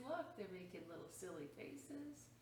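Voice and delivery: plain reading voice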